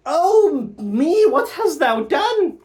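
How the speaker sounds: queen aghast at the killing; horrified cry. Acted. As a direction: wail in horror. Gertrude horrified.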